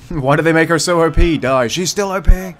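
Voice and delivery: loud, high-pitched complaint; exasperated outburst. Real, not acted. Player complains the elf is too strong, loud exasperation. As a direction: protest loudly in exasperation.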